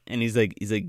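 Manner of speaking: normal talking voice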